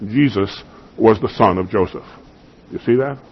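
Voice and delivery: ordinary talk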